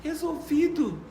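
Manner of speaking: put-on high, slow, contented child voice; satisfied child. Granddaughter after the spanking, high and contented. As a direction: sigh it out like a happy little girl. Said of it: "fala assim".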